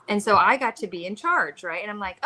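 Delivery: ordinary delivery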